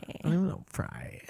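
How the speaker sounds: soft, creaky, breaking-up fry; vocal fry attempt. Shown as a demonstration. Co-host trying out vocal fry, soft and creaky. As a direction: let the voice crumble into creak. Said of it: "am I doing fry?".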